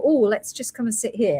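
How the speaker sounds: ordinary talk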